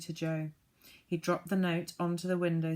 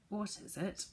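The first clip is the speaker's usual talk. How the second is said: hoarse; 3 semitones higher, 7 dB quieter than usual. A narrator's reading. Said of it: "he asked in a hoarse voice".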